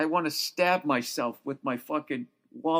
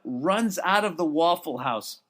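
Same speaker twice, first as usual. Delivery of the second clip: ordinary talk, nothing marked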